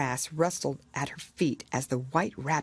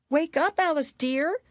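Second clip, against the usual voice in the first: put-on gentle older sister; 11 semitones higher, 4 dB louder than usual. Sister waking Alice on the bank, tender. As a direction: coax her gently awake. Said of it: "said her sister".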